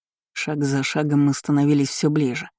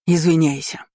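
barked order. A narrator's reading. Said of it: "рявкнул лорд Эйренберг".

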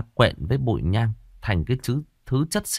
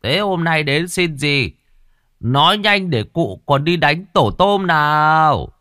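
higher, louder, slow mock elder's growl; gruff old spirit; 6 semitones higher, 4 dB louder, slower than usual. A narrator's reading. Girl playing a spirit demands offerings, slow bossy mock growl. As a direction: lord it over them like a grumpy old ghost. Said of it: "Nó gằn giọng".

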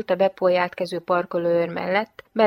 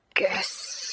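soft, voiceless hiss through bared teeth; snarled thanks; 7 dB quieter than usual. A narrator's reading. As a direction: toss it off as a quiet snarl. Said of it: "vicsorogva odavetette". (vicsorogva, halkan odasziszegve)

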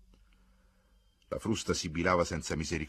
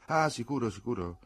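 mildly placating, starts higher then drops; meek hasty agreement; 6 semitones higher, 4 dB louder than usual. A narrator's reading. Bystander placating Charles about the sled, meek agreement. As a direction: back down meekly. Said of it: "si affrettò a rispondere l'uomo con tono mite".

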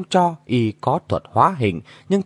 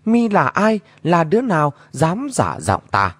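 higher-pitched echo of an angry challenge; mimicking shout; 6 semitones higher than usual. A narrator's reading. Hidden voice copying the goddess from inside the palanquin, high mimicked shout. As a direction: throw the challenge back as a mocking copy. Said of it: "có tiếng hét ra nghe y hệt".

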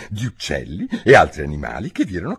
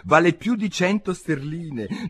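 ordinary delivery, nothing marked

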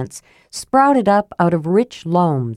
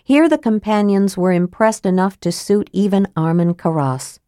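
ordinary delivery, nothing marked

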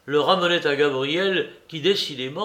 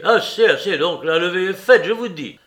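higher, louder "Assez, assez"; a raised, impatient command; loud order; 4 semitones higher, 7 dB louder than usual. A narrator's reading. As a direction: shut him down sharply. Larrier cuts the quarrel short.

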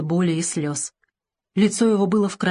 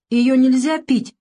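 put-on higher, slow little-boy voice; sensible, reasoning; 5 semitones higher, 4 dB louder than usual. A narrator's reading. Savushka corrects the doctor about the pill, reasonably. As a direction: explain it carefully as a wise toddler. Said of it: "рассудительно заявил Савушка".

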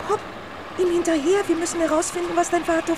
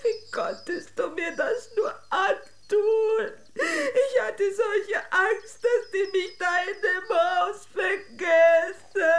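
slow, higher, crying complaint; tearful reproach; 7 semitones higher, slower than usual. Acted. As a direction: let the tears come, slowly and reproachfully. Princess reproaches girl, tearful. (weinerlich und langsam)

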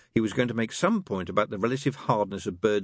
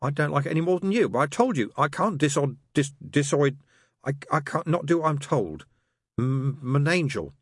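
put-on higher, fussy drunken angel stumbling over words; tipsy angel; 8 semitones higher, 3 dB louder than usual. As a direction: stammer it out like a flustered tipsy angel. Aziraphale, drunk, stammering protest.

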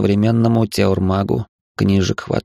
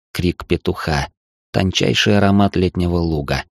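usual speaking voice

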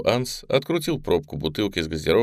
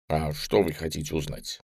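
chewing while talking; softer, munching on a marshmallow; mouth full; 3 semitones lower, 6 dB quieter than usual. Acted. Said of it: "чавкая произнёс гость из будущего". (с набитым ртом)

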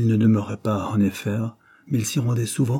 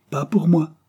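high, louder protest; exclaiming; 8 semitones higher, 5 dB louder than usual. A narrator's reading. André Brum rejecting the hospital for himself, exclaiming. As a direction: burst out in firm refusal. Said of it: "s'écria André Brum".

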